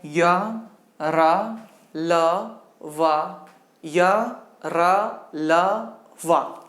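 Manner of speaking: "या, रा, ल, वा" one at a time with pauses; isolated semivowels. Shown as a demonstration. Teacher listing the semivowels for students, separate "या, रा, ल, वा". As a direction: articulators nearly meeting on each of "या, रा, ल, वा". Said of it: "परस्पर सटने की कोशिश करें".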